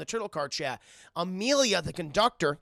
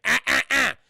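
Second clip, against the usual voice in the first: cat's scolding voice; 8 semitones higher, 11 dB louder than usual. Acted. Cat character refusing to stay.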